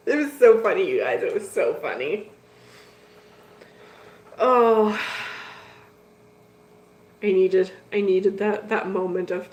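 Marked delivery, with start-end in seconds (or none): none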